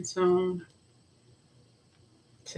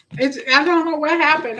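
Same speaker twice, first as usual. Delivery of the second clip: higher and louder, exasperated outburst; venting real frustration at a broken live feed; frustrated; 9 semitones higher, 7 dB louder than usual. Real, not acted. Streamer vents at failed live feed, frustrated and louder. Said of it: "I'm so frustrated".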